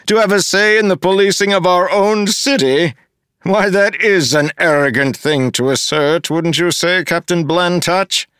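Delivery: a pompous official looking down on everyone; smug mayor. Acted. Mayor Gave demanding an apology from Wax, sneering.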